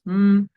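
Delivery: a loud closed-lip hum of the M sound; humming M. Shown as a demonstration. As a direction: press the lips together and hum mm for a child. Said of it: "tenés que juntar los labios". (zumbido mmm con los labios juntos, más fuerte)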